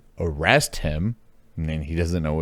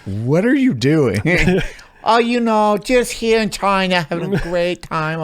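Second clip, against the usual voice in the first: high, loud mock Chinese accent, laughing through it; worst fake Chinese accent; 12 semitones higher, 7 dB louder than usual. Friend mocking a tourist in China in a fake Chinese accent. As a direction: ham up a terrible Chinese accent. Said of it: "Just do the worst accent".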